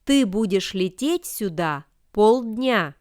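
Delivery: ordinary talk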